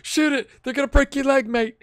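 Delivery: loud, high-pitched live reaction; alarmed excited shouting. Real, not acted. Gamer reacting to a dangerous tackle, excited shouting.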